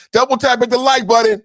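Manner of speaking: high, loud and fast hype call; shouted streamer hype. Real, not acted. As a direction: pump the chat up, shouting. Hyping viewers after the clip.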